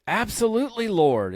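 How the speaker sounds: high, loud, emphatic yes; eager reply. Retold or imitated. Dreamer eagerly answering God's offer, excited. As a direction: answer with keen enthusiasm.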